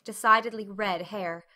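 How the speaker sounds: plain reading voice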